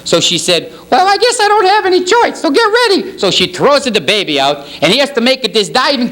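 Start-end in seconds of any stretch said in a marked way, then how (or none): 0.9-3.2 much higher, falsetto cry for help; hammed-up panicked lady screaming from a window; a trapped mother calling down from the burning building, frantic falsetto; frantic woman. Lady's voice again.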